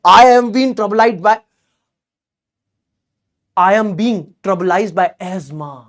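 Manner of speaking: very slow, weighty and posh-sounding English; heavy formal English. Shown as a demonstration. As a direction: lay each word down slowly to sound grand. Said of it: "सुन के कितना भारी लगता है". (बहुत धीमी, भारी अंग्रेज़ी)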